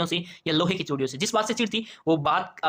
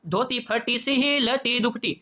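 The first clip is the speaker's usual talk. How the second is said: slow, higher poem recitation; 4 semitones higher, much slower than usual. Acted. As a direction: stretch the verse out slowly. Reciting the poem line again. (धीमा, ऊँचे सुर में कविता-पाठ)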